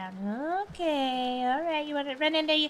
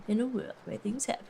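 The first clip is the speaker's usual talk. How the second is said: movie trailer voice; 5 dB quieter than usual. Shown as a demonstration. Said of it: "Movie trailer voice".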